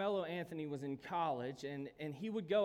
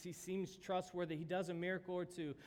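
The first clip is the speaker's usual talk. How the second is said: normal talking voice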